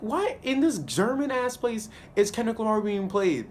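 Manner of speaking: high-pitched, baffled protest; hammed-up incredulity; incredulous question. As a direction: demand an explanation in comic disbelief. Acting out own baffled reaction.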